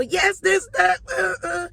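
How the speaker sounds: high, loud excited filler; loud cheery babble. Retold or imitated. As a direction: keep the hype going. [name] keeps up the cheer.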